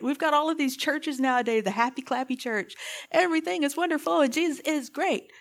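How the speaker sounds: ordinary talk, nothing marked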